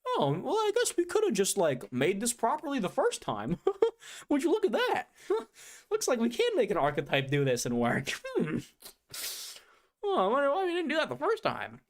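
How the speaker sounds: very high, smug mocking voice with giggles; mocking company voice. Mocking the card company with a smug high voice. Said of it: "be like".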